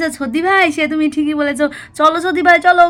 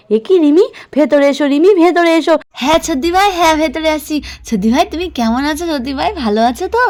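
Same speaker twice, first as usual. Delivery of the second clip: ordinary delivery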